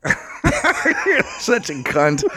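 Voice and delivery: slow, helpless laughing jab; laughing insult. Real, not acted. To a co-host after a muddled phrase, laughing. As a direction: say it through drawn-out laughter.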